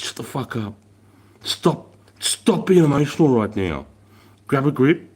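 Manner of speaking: high, hard retold telling-off; a mock sergeant ordering a recruit to get a grip; stern order. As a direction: snap him back into line. Commander snapping recruit out of panic.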